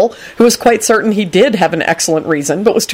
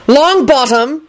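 high-pitched mild boom; 9 semitones higher, much slower than usual. A narrator's reading. Kingsley rebukes Neville in the kitchen, mild booming call. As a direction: boom the surname, pitch raised. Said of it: "Kingsley boomed".

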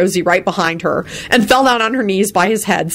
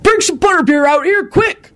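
bellow; 12 semitones higher than usual. A narrator's reading. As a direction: bellow to the elves. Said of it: "Hagrid bellowed".

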